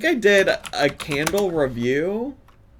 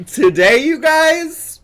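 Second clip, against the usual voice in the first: higher, slow, drawn-out imitation of the intro; mocking the YouTuber; 5 semitones higher, 7 dB louder, slower than usual. Repeating Kira's video intro back at the screen, mock perky. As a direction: mimic her perky opener, stretching it out.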